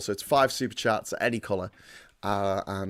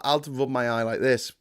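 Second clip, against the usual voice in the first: ordinary talk, nothing marked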